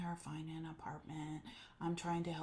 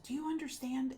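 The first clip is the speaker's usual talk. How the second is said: imitating; 7 semitones higher, 4 dB louder than usual. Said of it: "And she said".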